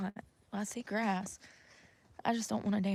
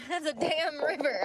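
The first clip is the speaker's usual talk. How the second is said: laughing; 6 semitones higher than usual. Real, not acted. Laughing at the river.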